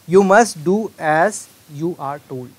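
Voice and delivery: very slow, loud example read; slow deliberate dictation. Shown as a demonstration. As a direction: spell it out slowly for the class. Teacher reads example sentence.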